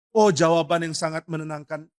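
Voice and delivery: ordinary talk, nothing marked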